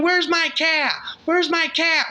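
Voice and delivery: a high, loud, frantic yell for a lost cat; yelling out the window. Acted. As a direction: holler it out the window at the neighbors. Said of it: "yelling out the window".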